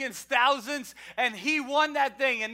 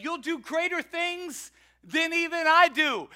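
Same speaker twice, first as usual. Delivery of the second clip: Jesus; 7 semitones higher, 6 dB louder than usual. Retold or imitated. Jesus quoted in sermon.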